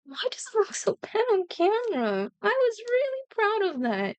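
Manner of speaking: high-pitched wail of disappointment; dismayed whine. Real, not acted. Painter to viewers about a painting looking bad on camera, dismayed whine.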